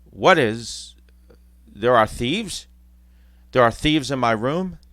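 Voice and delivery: rising, questioning alarm; slow, stunned questions; alarmed disbelief. Acted. Scrooge sees thieves.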